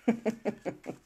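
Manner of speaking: real giggle, tailing down; short amused laughter; chuckle. Real, not acted. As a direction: laugh at being teased. Amused by teasing about accent.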